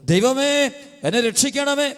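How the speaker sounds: high, impassioned sermon voice; fervent preaching. Acted. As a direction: preach it out with rising fervour. Preacher cries out a prayer. (ഉയർന്ന സ്വരത്തിൽ ആവേശത്തോടെ പ്രസംഗം)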